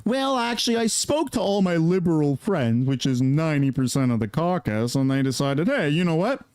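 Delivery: lightly voiced smarmy politician; a hint of a sly politician; mock politician. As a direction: explain it away, smooth and mocking. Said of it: "they're like".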